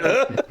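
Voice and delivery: high winded grunt. Retold or imitated. Hit by a late airbag, a high winded grunt. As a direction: grunt as the airbag hits you. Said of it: "and I went".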